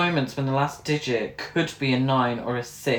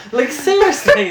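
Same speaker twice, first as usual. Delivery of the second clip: loud, high exasperation; exasperated; 11 semitones higher, 9 dB louder than usual. Real, not acted. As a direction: throw up your hands. Mocking the film.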